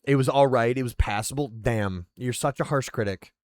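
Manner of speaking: ordinary talk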